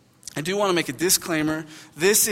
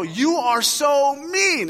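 high, slow, drawn-out mock outrage; retold indignant scolding; 9 semitones higher, 4 dB louder, much slower than usual. Dad telling off the little girl, hammed-up indignation. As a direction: stretch each word in offended disbelief.

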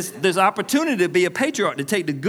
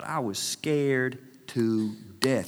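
slow, lingering words with pauses; drawn-out confession; 3 semitones lower, 4 dB quieter, much slower than usual. Retold or imitated. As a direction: let the confession sink in slowly. Repeats his confession of fear.

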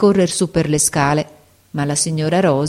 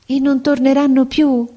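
naive question; 9 semitones higher than usual. A narrator's reading. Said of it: "domandò ingenuamente la barberina".

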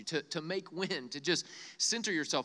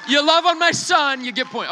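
loud, much higher shout of delight; excited shout; 9 semitones higher, 14 dB louder than usual. Real, not acted. Preacher shouting thanks to people who clapped for his son. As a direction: yell it out to the room, thrilled.

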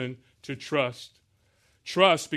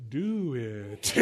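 low, soft, weary drawn-out groan; reluctant body; 4 semitones lower, 6 dB quieter than usual. Acted. Preacher voices his failing body to the congregation, low and weary. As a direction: drag it out low, as if worn out. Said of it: "And my body says".

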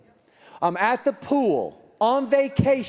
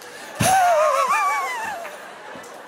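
high, wheezing laugh that trails away; long airy wheeze of laughter; smoker's laugh. Acted. Dad scoffing at doctor's advice, wheezy smoker's laugh. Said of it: "Smoker's laugh".

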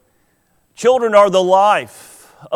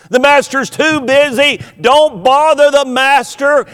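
"master's" stressed, higher and louder, shooing people off; mock scolding disciple; 6 semitones higher, 3 dB louder than usual. Preacher imitating disciples turning parents away, mock scolding. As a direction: shoo the parents away, a touch hammed-up. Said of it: "hear those disciples saying".

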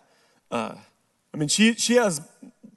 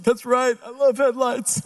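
high-pitched emotional woman impression; mock emotional; 7 semitones higher than usual. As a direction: play her choked-up agreement, pitched up. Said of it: "headlights can be kind of emotional".

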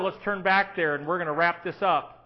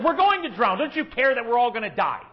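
higher and louder, frightened plea; panicked cry; 6 semitones higher, 7 dB louder than usual. Retold or imitated. Disciples begging Jesus, a touch of panic. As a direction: plead as if the boat is sinking. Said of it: "They just said".

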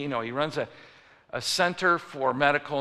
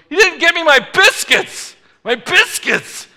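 very high, slow outraged bellow; angry shouting; 16 dB louder, slower than usual. Retold or imitated. Old man storms back for his missing biscuits, angry shouting. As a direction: scream each word in comic fury. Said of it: "he is angry".

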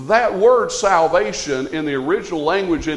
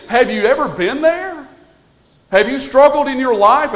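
higher, louder preaching; fervent preaching; 4 semitones higher, 4 dB louder than usual. Real, not acted. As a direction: preach it with fire. Preaching at full force.

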